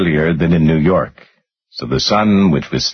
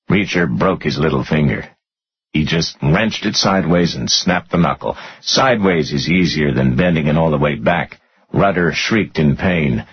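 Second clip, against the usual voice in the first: ordinary delivery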